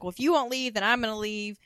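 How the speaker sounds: a father ranting that nobody bought him a VCR, loud; petulant tantrum. Retold or imitated. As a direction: throw an old man's tantrum. Henry's tantrum.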